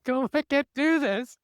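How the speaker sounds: mock wail, high and drawn out; imitated crying husband. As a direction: play a husband in tearful despair, hammed-up. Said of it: "Your husband was crying. He was like".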